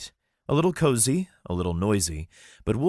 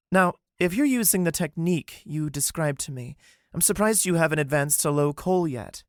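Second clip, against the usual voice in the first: higher, curious questioning; lightly voiced Callie, surprised; 10 semitones higher than usual. Callie questioning Wolf about his cultivation. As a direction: press him on his progress.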